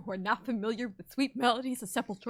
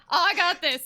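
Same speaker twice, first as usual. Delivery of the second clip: cocky; 7 semitones higher, 8 dB louder than usual. Retold or imitated. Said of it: "I was like".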